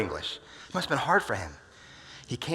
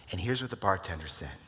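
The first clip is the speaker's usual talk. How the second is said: ordinary talk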